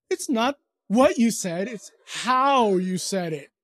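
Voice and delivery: slow, drawn-out HOW in "how you said it"; pointed correction. Retold or imitated. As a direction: contrast "what" with a long, leaned-on "how". Wife's retold reproof about his tone.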